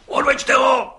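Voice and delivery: the district judge roars for the brickmaker to be taken away; roaring. A narrator's reading. Enraged roar. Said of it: "Zařval okresní soudce".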